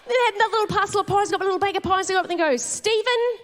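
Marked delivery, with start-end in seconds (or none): 2.6-3.4 calling out a name in a questioning way, a few times over. Retold or imitated. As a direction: call the name like a café worker looking for the customer. Calling out for pie order.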